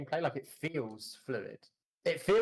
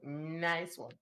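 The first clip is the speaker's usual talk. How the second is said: higher mimicry with a drawn-out N; imitating a friend's voice; 4 semitones higher than usual. Teasing the streamer over that voice, mock imitation. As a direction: copy the odd voice, stretching into "nice". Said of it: "What was that voice? You just like".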